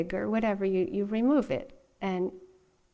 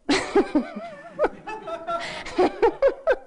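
big laugh; 4 dB louder than usual. Real, not acted. Funny twist: spider alive.

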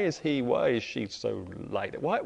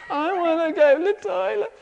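put-on much higher, whiny small child; little kid's voice; 8 dB louder than usual. The little daughter calls out, high whiny child voice. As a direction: pipe up like a toddler who needs to go. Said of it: "little kid".

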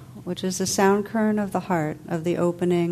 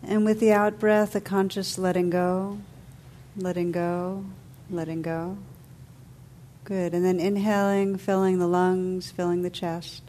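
calm, unhurried meditation voice with long pauses; guided meditation; 5 dB quieter than usual. Real, not acted. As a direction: guide listeners gently into stillness, letting phrases settle.

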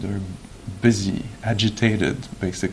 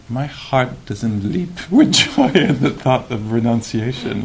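retold reaction breaking into a laugh, pitch jumping mid-sentence; mock dismay, laughed through; laughing protest; 3 semitones higher, 4 dB louder than usual. Amused retelling of own first reaction.

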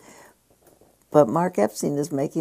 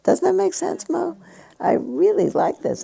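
delighted. Real, not acted. Delighted, asking Mo.

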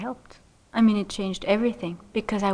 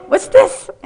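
sharp, much higher, loud what's this; startled exclamation; 12 semitones higher, 10 dB louder than usual. Retold or imitated. Teacher startled by a student bursting in, sharp surprise. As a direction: jolt up in pitch as if caught off guard.

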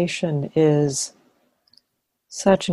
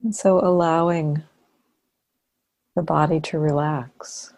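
calm, unhurried body-scan voice with long pauses; guided meditation. Real, not acted. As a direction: ease them into relaxing, phrase by phrase. Leads a body-scan relaxation.

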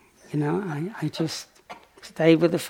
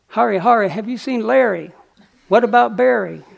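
higher, louder jeering sing-song; mock heckling; 6 semitones higher, 6 dB louder than usual. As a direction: taunt like a guard heckling the monk. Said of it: "the guards ... started heckling".

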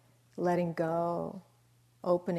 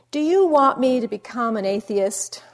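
exasperated; 4 semitones higher, 11 dB louder than usual. Retold or imitated. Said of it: "he says".

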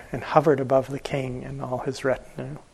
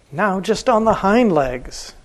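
slow, higher, louder royal command; roaring king; 7 semitones higher, 4 dB louder than usual. Acted. Jealous king roars his order at the elephant rider, slow and loud. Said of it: "roared the king".

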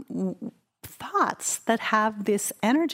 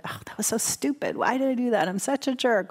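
self-critical grumble; 5 semitones higher, faster than usual. Retold or imitated. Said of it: "how many times have we all said".

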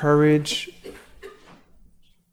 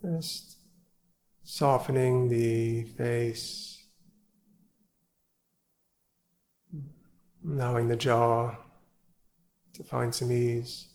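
guided meditation; 4 dB quieter than usual. Acted. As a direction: ease them into stillness, gently and unhurried. Leads evening sit meditation.

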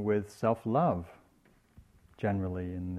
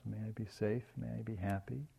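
very soft, gently lifted phrases; soft kindness wishes; 11 dB quieter than usual. Shown as a demonstration. Meditation teacher sending wishes of kindness to oneself, softly. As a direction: offer each wish quietly, as if to yourself.

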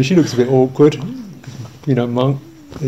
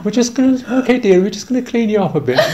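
nurse's cosy chatter; 8 semitones higher, faster than usual. Retold or imitated. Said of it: "And then".

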